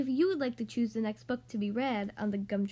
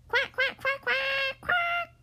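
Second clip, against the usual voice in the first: very high, loud little quacks climbing higher; duckling quacks; 13 semitones higher, 4 dB louder, slower than usual. Acted. Ducklings splashing into the water, very high playful quacking. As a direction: chirp out quacks like tiny ducklings.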